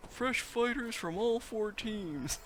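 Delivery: very high, slowly stretched announcer voice; Grogan impression. Gamer mimicking Grogan announcing the showdown, high drawn-out impression. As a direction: put on a high-pitched, loud arena commentator, drawing it out. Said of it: "That's my Grogan impression".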